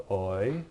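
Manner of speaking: oi as one gliding "oy", as in oil; oi like "oil" without the l; oi sound. Shown as a demonstration. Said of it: "this makes an oi sound".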